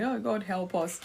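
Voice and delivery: ordinary talk, nothing marked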